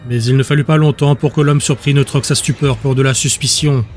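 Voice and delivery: normal talking voice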